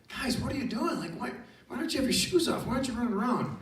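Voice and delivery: high, incredulous questioning; playfully baffled at the others' lack of fun; high-pitched, eager protest; excited disbelief. Retold or imitated. Baffled, excited teacher questions lagging students.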